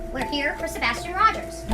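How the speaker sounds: ordinary talk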